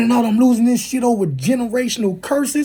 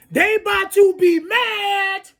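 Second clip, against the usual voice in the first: slow angry shout; 6 semitones higher, slower than usual. Real, not acted. Angry rant about YouTube.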